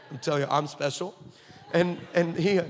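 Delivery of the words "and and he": ordinary talk, nothing marked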